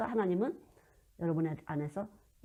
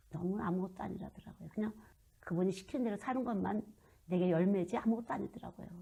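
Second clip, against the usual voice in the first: normal talking voice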